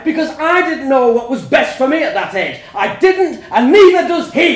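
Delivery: very loud, high shouting; furious shout. A narrator's reading. Parents shouting at each other over the child. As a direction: blast it across the table.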